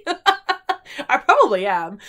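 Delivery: slow, laughing admission. Real, not acted. Joking she sounds like a bogan Aussie, laughing. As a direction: laugh it out at your own expense.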